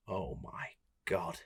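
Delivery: low, quiet and very slow; hushed realisation. Retold or imitated. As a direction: let the horror sink in under your breath. Dread on realising who answered.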